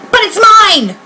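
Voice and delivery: screamed. Acted. Maisie claiming the egg from Horton, a high screech. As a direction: shriek it to take the egg back. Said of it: "screamed the bird".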